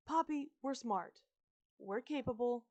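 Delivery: reassuring. A narrator's reading. Isabella reassuring.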